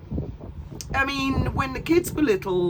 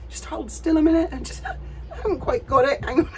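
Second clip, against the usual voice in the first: fussing waiter; 8 semitones higher than usual. Retold or imitated. Waiter voiced painting foam.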